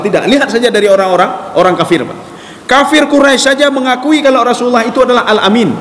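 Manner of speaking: ordinary talk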